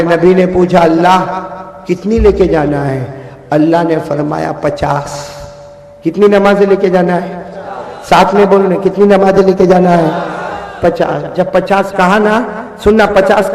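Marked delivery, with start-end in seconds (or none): none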